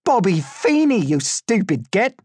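loud, high exasperated yell; exasperated high-pitched insult; angry correction. A narrator's reading. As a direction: shout the name at an idiot. Captor correcting prisoner after hitting him.

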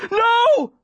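very high cry of horror; scream. A narrator's reading. Boy seeing Aaron take his chance, high horrified scream. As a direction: scream it out, pitched right up. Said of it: "I scream".

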